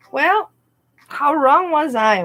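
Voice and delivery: sarcastic outburst. Real, not acted. Sarcastic outburst at her own past.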